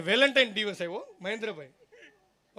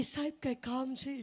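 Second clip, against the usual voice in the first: lightly raised, polite hostess asking; air hostess voice; 4 semitones higher, 9 dB quieter than usual. Retold or imitated. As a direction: play a courteous air hostess, a little higher. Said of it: "એ કે".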